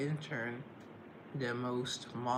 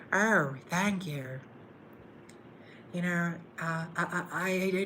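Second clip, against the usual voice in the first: Jennifer Coolidge voice; 4 semitones higher than usual. Retold or imitated. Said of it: "I just embody Jennifer Coolidge".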